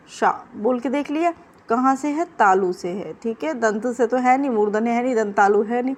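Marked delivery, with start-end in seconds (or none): none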